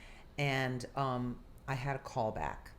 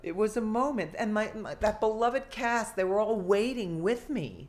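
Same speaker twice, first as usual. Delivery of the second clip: emotional; 7 semitones higher, 8 dB louder than usual. Real, not acted. Emotional recollection of being cast.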